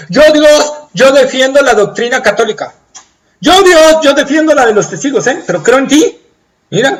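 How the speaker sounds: high, loud self-justifying plea; defensive boasting. Acted. Religious man arguing his creed before God, high and loud. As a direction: argue your credentials to God.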